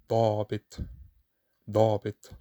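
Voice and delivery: dabit with a heavy, dark emphatic D. Shown as a demonstration. Teacher modelling the emphatic D in dabit. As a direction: dabit with the tongue pulled back. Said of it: "is pronounced do".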